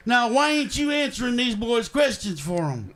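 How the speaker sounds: Grandpa Grumpy. Acted. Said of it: "This here's Grandpa Grumpy".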